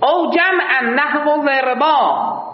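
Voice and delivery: slow, drawn-out chant of او جمعا نحو ضربا; slow recitation. Shown as a demonstration. Teacher chants the Arabic verse slowly to students. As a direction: intone the verse line slowly. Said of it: "reads verse text".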